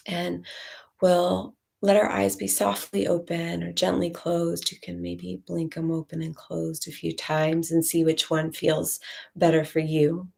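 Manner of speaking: usual speaking voice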